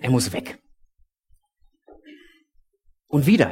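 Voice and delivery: usual speaking voice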